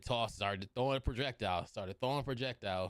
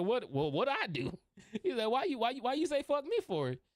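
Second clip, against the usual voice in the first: high-pitched, stammering disbelief; indignant protest; 12 semitones higher, 3 dB louder than usual. Acted. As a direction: play a comically baffled victim, voice shooting up. Johnny protests being picked to fight.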